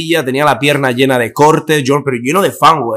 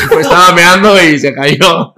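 words squeezed through real laughter, slowed; laughing; 4 semitones higher, 4 dB louder than usual. Real, not acted. Repeating what a witness said about his fall, laughing through it.

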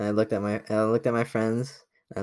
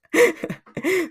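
a sudden high burst of laughter; 5 dB louder than usual. Real, not acted. High laugh after exposing the name. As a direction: laugh it off, caught out.

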